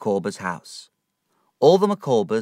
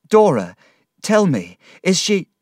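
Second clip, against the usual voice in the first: frantic plea; 10 semitones higher, 7 dB louder than usual. Acted. Begging news of dying wife.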